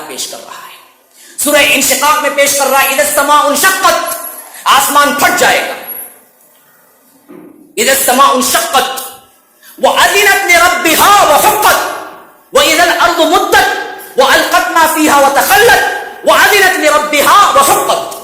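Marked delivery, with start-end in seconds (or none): 2.9-4.0 chanted recitation, melodic and raised; Quranic recitation. Acted. Preacher reciting the verse to the congregation, chanted. 7.8-18.2 chanted recitation, melodic and raised; Quranic recitation. Acted. Preacher reciting the verse to the congregation, chanted.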